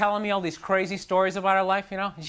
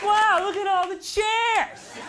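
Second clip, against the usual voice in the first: much higher, whiny near-crying; mock self-pity; 11 semitones higher, slower than usual. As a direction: realize you're alone halfway through. Empty chairs, alone.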